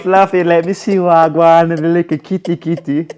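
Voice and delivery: loud, high patois catcall; hammed-up Jamaican sweet talk; flirting Jamaican man. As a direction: holler a playful come-on at a woman. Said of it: "He must say".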